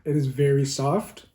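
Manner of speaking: normal talking voice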